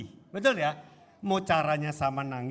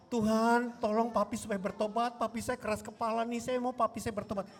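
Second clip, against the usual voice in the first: higher, evenly pitched plea to God; pleading sample prayer; 5 semitones higher than usual. Shown as a demonstration. Child asks God that papi repent, pleading prayer. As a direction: act out an earnest prayer on a raised, steady note.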